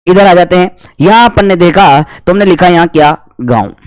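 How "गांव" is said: normal talking voice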